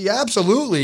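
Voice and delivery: high, mock-emphatic confirmation; voicing Greg. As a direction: play the friend who's sure of it. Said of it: "And he said".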